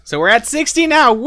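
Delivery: high, loud, gleeful announcement; excited. Real, not acted. Streamer celebrating sixty subscribers on stream, high loud gleeful cry. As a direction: crow it out in triumph.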